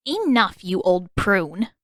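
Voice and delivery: put-on an old woman scolding a gloomy man. A narrator's reading. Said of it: "without your dramatics".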